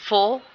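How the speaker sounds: "फो" loud, isolated and held; strong, drawn-out FO for "फो"; stressed single syllable. Shown as a demonstration. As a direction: punch "फो" on its own. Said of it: "वो primary syllable है".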